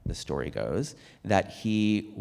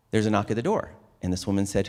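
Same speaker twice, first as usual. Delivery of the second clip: lifted, lively storytelling voice; story reading; 4 semitones higher than usual. Acted. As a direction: read it like a cherished tale. Reads famous story aloud.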